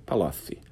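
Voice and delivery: "Palocci" lisped as Palofi; "Palocci" with tongue between teeth. Shown as a demonstration. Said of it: "Romário falando Palocci".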